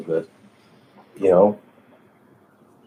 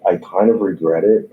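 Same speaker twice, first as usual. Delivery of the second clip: ordinary delivery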